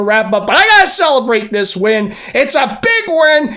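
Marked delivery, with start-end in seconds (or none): 2.3-3.5 excited. Real, not acted. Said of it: "a little excited".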